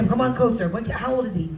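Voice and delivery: normal talking voice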